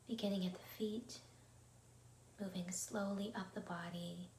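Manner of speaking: soft, unhurried meditation voice with long pauses; guided meditation. Acted. Teacher leading listeners through an evening body scan, meditative. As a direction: guide them gently inward, quietly, leaving space between phrases.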